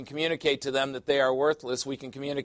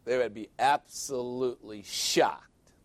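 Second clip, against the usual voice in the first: slow emphasis. Acted. Repeats the line, drawn out.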